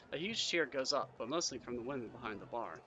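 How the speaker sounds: ordinary delivery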